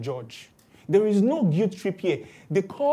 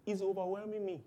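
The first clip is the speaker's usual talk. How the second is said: much softer, higher, near breaking; quiet overwhelmed reply; 6 semitones higher, 12 dB quieter than usual. Retold or imitated. Believer quietly answering Christians who say he lacks faith, overwhelmed. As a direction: plead it under your breath. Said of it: "But Christians say".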